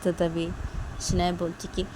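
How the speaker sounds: ordinary delivery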